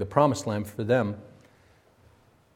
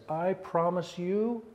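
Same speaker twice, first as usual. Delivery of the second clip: a hint of an upset God, higher and slow; angry God; 6 semitones higher, 4 dB quieter, slower than usual. Pastor voicing an upset God vowing to Israel, mildly angry. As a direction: swear it slowly from on high. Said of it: "an angry God who is so upset".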